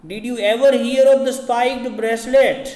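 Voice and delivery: loud, high, slow astonished question; amazed shout at the priest; crying out. A narrator's reading. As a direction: demand it loudly in disbelief. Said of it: "cried Flambeau".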